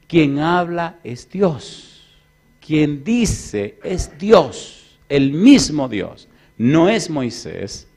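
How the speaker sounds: very slow, loud and deliberate; slow emphatic declaration. Acted. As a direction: weigh every word.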